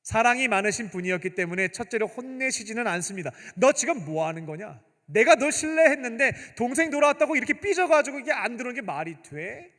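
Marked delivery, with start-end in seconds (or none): 3.6-9.7 higher and louder, a rebuking father; scolding father. Retold or imitated. Father scolding his sulking eldest son, mock rebuke. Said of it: "질타하시지 않았습니다".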